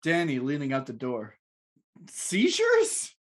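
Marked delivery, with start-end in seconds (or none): 2.1-3.1 high incredulous shout. Acted. Danny shouts after landlord.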